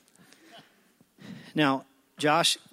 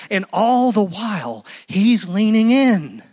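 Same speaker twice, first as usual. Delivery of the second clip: building emphasis; 4 semitones higher, 4 dB louder than usual. Real, not acted. Preacher builds to climax.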